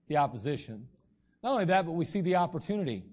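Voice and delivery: ordinary talk, nothing marked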